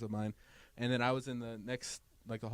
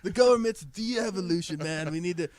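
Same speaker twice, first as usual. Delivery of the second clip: high, loud hammed-up stoner rant; 5 semitones higher, 5 dB louder than usual. Podcast host riffing as a stoner about government, hammed-up loud. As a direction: preach it like a baked hippie.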